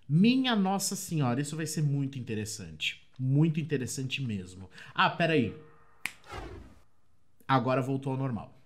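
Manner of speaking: normal talking voice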